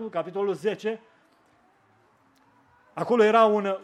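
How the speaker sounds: ordinary talk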